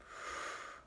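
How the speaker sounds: long, soft exhale; calming breath out. A narrator's reading. Wife following husband's breathing, slow exhale. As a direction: let the breath out slowly.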